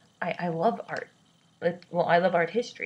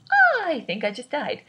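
high, loud, slow mock gasp of death; mock dying; 8 semitones higher, 7 dB louder than usual. Reacting to a huge school bill, hammed-up high slow mock death. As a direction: pretend the bill just killed you.

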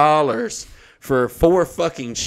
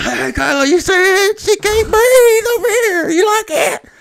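very high, loud, cry-like squeal; hammed-up high wail taunting the wife; mock shrieking taunt; 9 dB louder than usual. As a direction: shriek it up in a wild, mocking falsetto. Mock taunt while drowning her.

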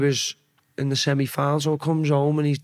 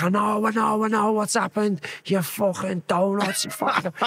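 higher, slowed-down imitation of a fuming dad; mock angry dad; 5 semitones higher than usual. Dad on the phone telling off his son, mock furious.

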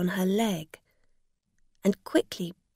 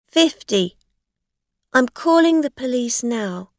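firm; 5 semitones higher, 8 dB louder than usual. Acted. Rosa threatens.

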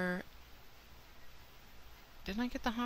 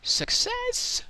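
high, loud triumphant cheer; a much higher, louder victory cry; excited cheer; 6 dB louder than usual. Real, not acted. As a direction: shout it out, thrilled it worked. Redstone farm works.

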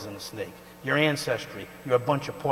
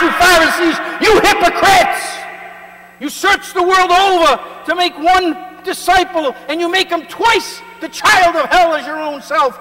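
high, loud furious denunciation; Jesus rebuking; 15 dB louder than usual. Acted. Jesus cussing out the Pharisees, high furious shout. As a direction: thunder at the hypocrites with full fury. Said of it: "That's how Jesus cussed".

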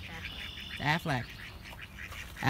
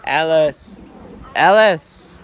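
calling out louder each time, ending in a shout; loud sharp voice; 7 dB louder, slower than usual. Real, not acted. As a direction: build from a call to a sharp yell. Calling daughter in pool.

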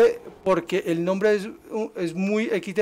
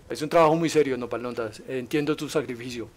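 mock solemn; 6 semitones lower, 4 dB quieter than usual.

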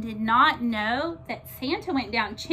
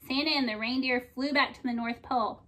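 usual speaking voice

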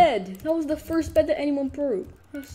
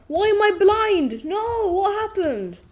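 loud, high alarmed questions; panicked cry; 7 semitones higher, 5 dB louder than usual. Real, not acted. Gamer suddenly blinded, loud high panicked cry. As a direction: shout in confused panic.

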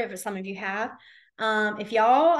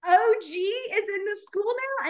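high, slow disbelief; teacher amazed at kids' new slang; astonished; 9 semitones higher, slower than usual. Retold or imitated. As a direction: stretch out the amazement. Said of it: "And I was like".

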